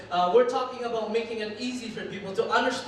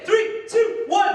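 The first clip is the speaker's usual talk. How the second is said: high, loud hype countdown; booming motivational speech; 10 semitones higher, 6 dB louder, slower than usual. Real, not acted. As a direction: pump up the crowd, rising each number. Hyping up a conference crowd.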